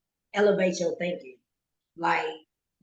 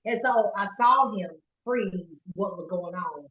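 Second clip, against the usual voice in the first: ordinary delivery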